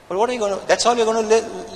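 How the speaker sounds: ordinary talk, nothing marked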